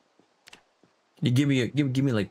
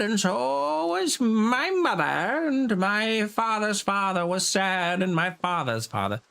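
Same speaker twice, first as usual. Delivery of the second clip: sad crybaby lad; 6 semitones higher than usual. Acted. Player hamming up a miserable sad lad while foraging. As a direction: whine it like a self-pitying sad lad. Sings then mopes in persona.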